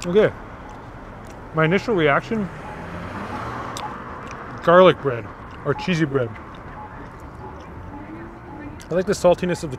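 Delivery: speaking between bites; talking while chewing. Real, not acted. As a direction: mouth partly full of pizza. Seen 0: Bites slice at -3; lips closed, working mouth while talking after.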